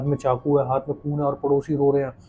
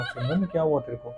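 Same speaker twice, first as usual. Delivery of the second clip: softer, hushed question with a laugh in it; soft worried ask; 8 dB quieter than usual. A narrator's reading. Husband touches wife's shoulder, asks softly what is wrong. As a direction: gently ask her what's wrong. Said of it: "कंधे पे हाथ रख के कहा".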